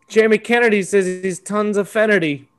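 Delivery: ordinary delivery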